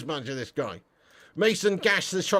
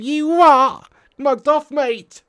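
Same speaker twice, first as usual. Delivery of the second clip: higher, louder indignant yelp; mock disbelief; 10 semitones higher, 11 dB louder, much slower than usual. Mock outrage at a hundred-million transfer fee. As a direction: sound stunned at the price.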